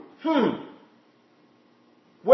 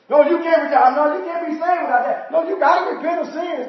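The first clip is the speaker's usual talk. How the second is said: mocking quote; 6 semitones higher than usual. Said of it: "they'll say that verbally".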